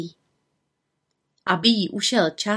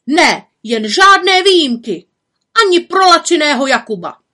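high, loud indignant refusal; crowd shouting; 7 semitones higher, 8 dB louder than usual. A narrator's reading. People by the flower girl refuse Jakub, shouting. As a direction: shout him down from the front of the queue. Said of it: "křičeli lidé vpředu u holčičky".

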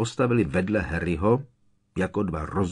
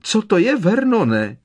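frightened shriek; 13 semitones higher, 8 dB louder than usual. A narrator's reading. Petunia terrified by noise.

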